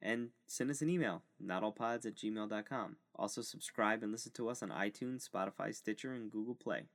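quick steady promo read. Acted. Host reading the podcast plugs, steady ad voice. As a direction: read the plug like a radio outro.

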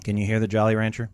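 sucking a hard candy; candy in mouth. Real, not acted. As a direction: talk around a Jolly Rancher. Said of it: "I can hear it".

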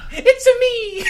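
very high, loud cartoon greeting; goofy put-on character squeal; silly Reinhardt voice. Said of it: "new voices for Reinhardt".

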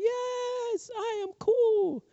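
put-on high, light voice of a proud little kid. Preacher recalling his boyhood jump, puffed up and proud. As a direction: crow like a kid who thinks he's cool.

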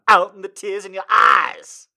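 high, loud hammed-up declaration; mock dramatic line. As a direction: declaim it like a soap hero.

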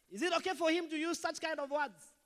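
indignant MP. Retold or imitated. MP asks Madam Speaker.

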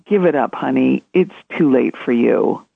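a hint of a defeatist inner critic, retold; voice of hopelessness. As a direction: voice the nagging doubt in your head, pitched low. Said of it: "that is the voice of hopelessness".

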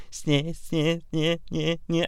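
soft nasal sneh sounds; imitated chop noise. Describing Road Dogg's chops, quiet mock noises. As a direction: mimic the crotch chop with quiet snehs. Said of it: "So it's like".

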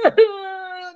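put-on high wailing cry of frightened kids; a child's startled cry. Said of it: "All the kids are gonna".

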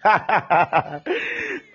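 quiet delighted chuckle; a soft laugh to yourself. Real, not acted. Pastor delighted by his own preaching, soft chuckle.

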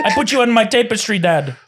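hammed-up Jamaican accent. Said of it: "In Jamaica, they say tapestry".

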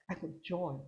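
mock joyless "I got joy"; joyless mumble. Shown as a demonstration. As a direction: say "I got joy" like you have none. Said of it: "Where's the joy?".

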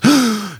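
sharp high delighted gasp; excited gasp. Retold or imitated. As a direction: gasp in happy surprise. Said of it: "and she goes".

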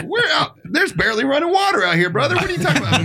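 very high-pitched mock disbelief; incredulous. Musician scoffing at organizer's media worry, high comic incredulity. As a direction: squeal the objection, close to laughing.

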